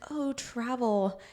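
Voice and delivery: high, slow starry-eyed sigh over travel; imitating people who romanticize trips; mock dreamy gush. Friend mocking how everyone romanticizes travel, high dreamy gush.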